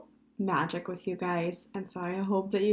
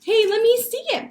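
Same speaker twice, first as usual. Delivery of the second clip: put-on high, loud, excited child; eager boy; 10 dB louder than usual. A narrator's reading. Benji asking to see brother's frog, excited. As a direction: pipe up loudly, dying to see.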